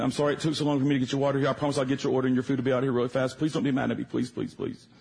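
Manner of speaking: rushed pleading apology. Retold or imitated. Waitress apologizing to waiting customers, rushed pleading. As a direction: imitate a flustered, hurried plea.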